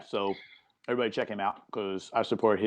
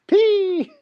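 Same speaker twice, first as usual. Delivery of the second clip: excited; 6 dB louder than usual. Real, not acted. Punchline of a riddle.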